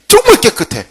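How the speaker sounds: teacher stressing how upright he was, high; emphatic. Real, not acted. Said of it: "emphasis on cleanness".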